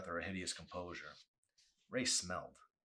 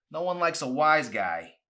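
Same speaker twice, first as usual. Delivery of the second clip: loud, higher sneering warning; gum-smacking boss; 9 semitones higher, 15 dB louder than usual. Acted. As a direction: lean in close and needle him. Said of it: "smacking his lips with gum".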